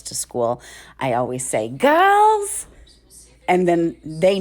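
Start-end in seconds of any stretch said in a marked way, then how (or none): 1.7-2.6 high, drawn-out singsong call. Retold or imitated. Mom rallying her girls on the school drive, singsong call. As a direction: call out high and loud, holding the note.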